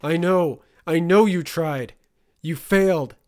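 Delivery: loud, high, furious yell; screaming back. Acted. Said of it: "Turner screamed back".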